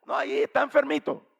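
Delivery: high, loud brush-off; dismissive brush-off. Retold or imitated. Wife getting rid of the stranger, dismissive. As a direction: raise the voice to send him away. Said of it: "Le digo así como para sacarlo".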